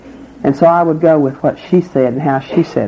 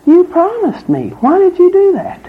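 shocked betrayal; 13 semitones higher than usual. Acted. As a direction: wail it like you've just been betrayed. Bitten man confronts snake.

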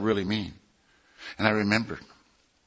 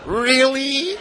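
very high, drawn-out squeal of wonder; amazed disbelief; 3 dB louder than usual. Retold or imitated. Newcomer told he is the favorite, acted amazement. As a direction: soar up high as if hardly daring to believe it. Acts his own amazed reply.

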